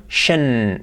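"shen" drawn out; isolated, lengthened "shen"; SHUN ending. Shown as a demonstration. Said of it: "Las acabas en tion".

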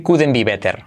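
Spanish-accented couldn't be better; heavy Spanish-style mistake; wrong Spanish accent. Shown as a demonstration. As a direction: read it as spelt, with Spanish r. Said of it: "pero intenta no decir".

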